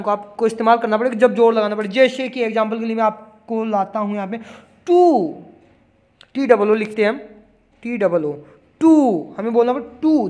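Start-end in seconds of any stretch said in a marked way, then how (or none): 4.9-5.3 टू pitched much higher and louder on a long oo; teacher hitting टू hard; stressed vowel. Shown as a demonstration. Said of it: "जोर लगाया". 8.8-9.3 टू pitched much higher and louder on a long oo; teacher hitting टू hard; stressed vowel. Shown as a demonstration. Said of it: "जोर लगाया".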